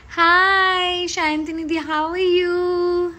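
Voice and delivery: high, loud, drawn-out sing-song hello; sing-song greeting. Real, not acted. Greeting a fan from her live chat, sing-song and high. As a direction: call out the hello like a happy tune.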